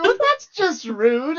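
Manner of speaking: high, slow indignant drawl; mock offended. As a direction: play up the offence, slowly. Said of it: "I'm like".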